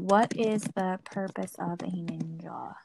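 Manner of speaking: soft whisper, typing a search. Real, not acted. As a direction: murmur it under your breath, reading. Reads a search aloud.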